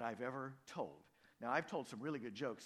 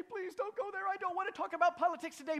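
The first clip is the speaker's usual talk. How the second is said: high, pleading objection, rushed at the end; lightly hammed-up protest; mock pleading congregant; 7 dB louder, faster than usual. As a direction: play a worried listener begging him not to go there. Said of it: "you may say".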